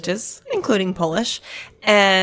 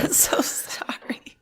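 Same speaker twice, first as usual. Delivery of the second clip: laughing apology; 6 dB quieter, slower than usual. Real, not acted.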